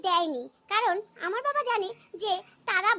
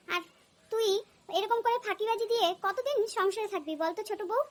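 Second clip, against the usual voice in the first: ordinary talk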